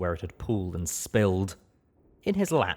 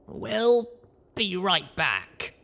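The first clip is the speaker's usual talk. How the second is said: higher, rising surprised question; young mare voice; 11 semitones higher, much slower than usual. Acted. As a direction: Blackjack caught off guard. Blackjack excuses herself.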